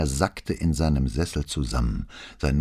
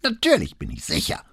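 high, testy insistence; old notary standing his ground, voice raised in pitch; insistent old man; 7 semitones higher than usual. A narrator's reading. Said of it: "beharrte Filippetto".

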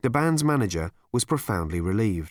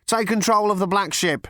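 third voice; 10 semitones higher, 4 dB louder than usual. Acted. Stage crew announcing the stunt ship, high and loud.

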